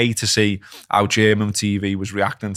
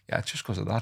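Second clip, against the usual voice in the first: calm hindsight; 10 dB quieter, faster than usual. Acted. Acting calm hindsight over tea.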